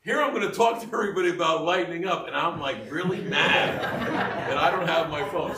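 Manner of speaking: high and loud, breaking into laughter; mock-mad quote dissolving in giggles; laughing at herself. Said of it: "I started laughing 'cause I'm like".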